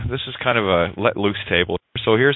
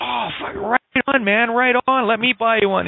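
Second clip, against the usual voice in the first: high-pitched, hammed-up congratulating bartender; all excited; 11 semitones higher than usual. Bartender congratulating a celebrating customer, acted excitement. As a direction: cheer him on with acted excitement. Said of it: "the bartender gets all excited".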